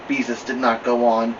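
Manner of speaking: ordinary delivery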